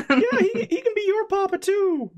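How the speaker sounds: very high laughing goblin voice. Acted. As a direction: squeak it through a giggle. Goblin Prince voice.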